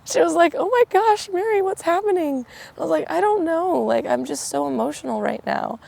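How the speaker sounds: high, choked-up retelling; welling up while telling the story; real emotion pushing the voice up high; getting emotional. Real, not acted. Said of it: "I'm getting emotional thinking about it".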